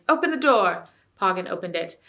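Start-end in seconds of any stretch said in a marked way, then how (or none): 0.1-0.9 loud, higher urgent shout. A narrator's reading. King dashing back to the stable, shouting. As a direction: yell it across the stable.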